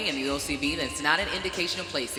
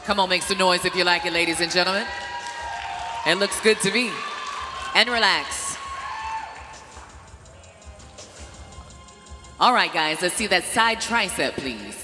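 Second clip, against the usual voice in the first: booming pumped announcer; 2 semitones higher than usual. Acted. Emcee calls poses, crowd hype.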